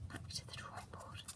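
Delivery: resigned whisper; soft defeated whisper. Real, not acted.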